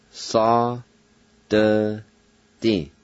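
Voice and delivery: Sa, de, di syllable by syllable; Cherokee pronunciation. Shown as a demonstration. Teacher sounding out the word for trap. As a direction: sound out Sa, de, di clearly.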